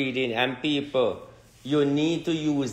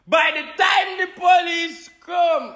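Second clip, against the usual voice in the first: mock pleading shout; 14 semitones higher, much slower than usual. Begging aliens not to land in Jamaica, hammed-up high shout. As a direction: beg at the top of your voice.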